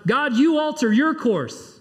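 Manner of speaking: mock defiant demand to God. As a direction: tell God to move, stubbornly. Voicing defiant believer at God.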